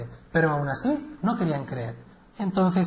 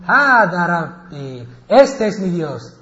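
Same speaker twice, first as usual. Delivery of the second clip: louder and slow, each word weighed; slow solemn proclamation; 7 dB louder, much slower than usual. Acted. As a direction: announce the moon as god in awe. Ibrahim proclama la luna. (proclamación lenta y fuerte)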